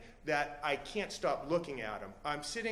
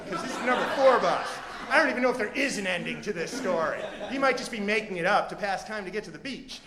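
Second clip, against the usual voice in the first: loud, higher, urgent rant; worked-up impatience; 5 semitones higher, 7 dB louder than usual. Acted. Comic fretting about missing his show, loud and agitated. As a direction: build a frantic case, pushing every phrase. Teller acts out mounting impatience.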